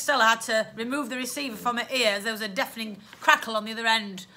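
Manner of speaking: plain reading voice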